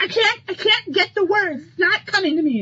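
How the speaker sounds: high, frustrated diva whine; whiny Mary Jane. Retold or imitated. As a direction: whine that the words won't come. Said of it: "she's like".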